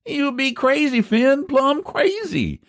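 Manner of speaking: high, slow, disbelieving cry; he can't believe his ears; incredulous old man. Acted. Eph hears the price demand.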